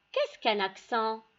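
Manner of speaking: usual speaking voice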